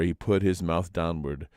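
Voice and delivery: ordinary delivery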